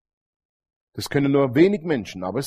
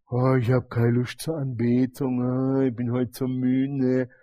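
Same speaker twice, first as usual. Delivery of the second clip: mock tired whining; 4 semitones lower, 6 dB quieter than usual. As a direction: sigh it out like someone who can't be bothered. Acted lazy complaint of the heart.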